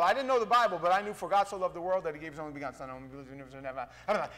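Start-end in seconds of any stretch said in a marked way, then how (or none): 1.1-3.9 very fast, running out into a mumble; rattled-off verse. Acted. Someone who didn't know the Bible, rattling off the verse. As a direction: race through it, fading as memory fails.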